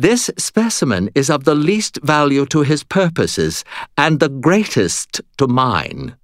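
high, imperious ghostly noblewoman; lofty and disdainful, looking down; haughty ghost lady. Acted.

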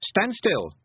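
high, restrained shout; commanding shout. A narrator's reading. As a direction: try to sound in command. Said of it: "she shouted, trying to sound commanding".

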